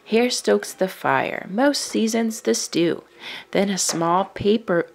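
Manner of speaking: usual speaking voice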